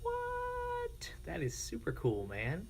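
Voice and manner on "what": ordinary talk, nothing marked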